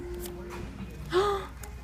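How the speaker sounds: soft gasp of discovery; delighted gasp. Real, not acted. Shopper spotting a card she likes, soft gasp. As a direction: gasp quietly at a find. Spots something while choosing a card.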